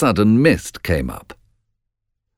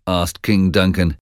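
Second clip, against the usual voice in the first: ordinary delivery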